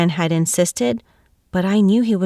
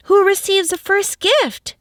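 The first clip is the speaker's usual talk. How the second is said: put-on eager children; 11 semitones higher than usual. A narrator's reading.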